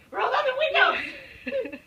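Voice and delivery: high, loud, slow laughing scream. Retold or imitated. Shrieking at Rob to roll down the windows, laughing scream. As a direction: scream it like in a horror movie through laughter. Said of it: "I screamed".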